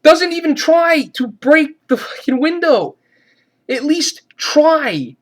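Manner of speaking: high, drawn-out exasperation; exasperated rant. Real, not acted. Reviewer faulting the trapped man for not trying, exasperated. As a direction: stretch the words in real disbelief. Ranting at a film character's stupidity.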